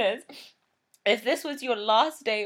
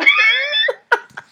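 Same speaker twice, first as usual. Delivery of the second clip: snorting laughs; 17 dB louder than usual. Real, not acted. Awkward intro, laughing fit.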